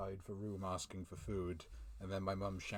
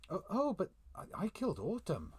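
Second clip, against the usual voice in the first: much higher and louder, blurted in alarm; startled realisation; 12 semitones higher, 6 dB louder than usual. Acted. Character realising he killed Autumn, high startled blurt. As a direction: gasp it out as the truth hits.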